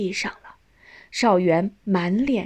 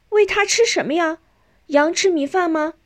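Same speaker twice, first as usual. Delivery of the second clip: put-on high, eager child's voice; curious children; 10 semitones higher, 4 dB louder than usual. A narrator's reading. Neighbour children crowding round a new sheep, curious. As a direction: ask like an inquisitive little kid.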